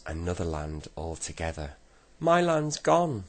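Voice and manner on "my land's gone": high, loud, drawn-out wail; shriek. A narrator's reading. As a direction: shriek it slowly in shock. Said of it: "shrieked the Saucepan Man".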